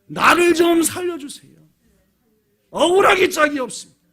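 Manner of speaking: 다윗's lament acted as a loud, high outcry; 억울한 탄식. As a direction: cry out aggrieved, pleading for help. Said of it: "탄식이었습니다". (높고 크게 억울함을 호소하는 외침)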